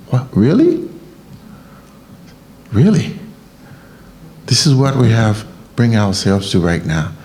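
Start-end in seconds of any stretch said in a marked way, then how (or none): 0.1-3.2 incredulous. Real, not acted. Incredulous reaction.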